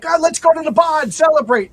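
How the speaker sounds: high, loud goofy guy voice; sitcom husband. Retold or imitated. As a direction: ham it up like a sitcom dad. Husband's gang heads to bar.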